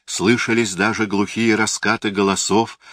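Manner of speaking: ordinary delivery, nothing marked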